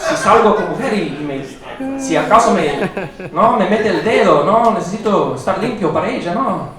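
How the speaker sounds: alter ego Nicolas. Pretend. Comic in his alter ego greets the crowd as Nicolas. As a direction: play the cocky gringo comic Nicolas. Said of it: "Back to Nicolas".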